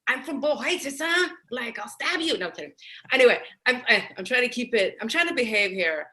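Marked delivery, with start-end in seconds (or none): 0.1-2.4 tough East LA Chicano accent, higher and louder; joking tough-girl East LA accent. Shown as a demonstration. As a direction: put on a thick East LA Chicana accent and play it mock-menacing. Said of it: "but I could go".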